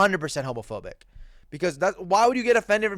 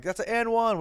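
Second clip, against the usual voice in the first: mock and-one call; 6 semitones higher, 3 dB quieter than usual. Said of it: "no, I'm kidding".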